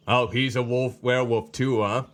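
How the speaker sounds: ordinary delivery, nothing marked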